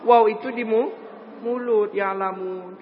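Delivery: ordinary talk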